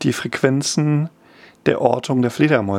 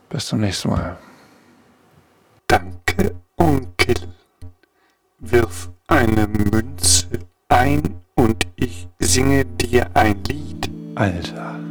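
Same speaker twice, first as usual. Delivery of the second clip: fortune machine; 2 semitones lower, slower than usual. Acted. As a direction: play a creaky talking fortune machine. Boxed machine character speaks.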